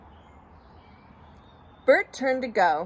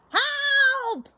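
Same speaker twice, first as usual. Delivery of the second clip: very high, stretched cry; scream. A narrator's reading. Bert runs for the mirror, high panicked scream. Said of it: "screamed Bert".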